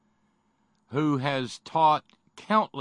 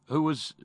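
"was" lifted and emphasized; stress on WAS; 4 semitones higher than usual. Real, not acted. Host introducing a former state employee, pointed stress on "was". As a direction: lean hard on "was", then pause. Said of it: "emphasis on the word was".

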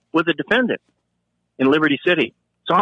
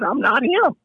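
pitched-up protest on "I'm not him"; insist, ending up on "him"; high insistent denial; 12 semitones higher, slower than usual. Retold or imitated. Officer to kicking detainee, high insistent "him".

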